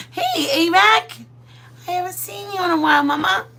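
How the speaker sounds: high, gushing voice for a pet; pet greeting. Real, not acted. Greeting the dog in a high cooing voice.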